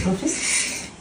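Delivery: a soft unvoiced whisper; hushed whisper. Real, not acted. Whispering to her son.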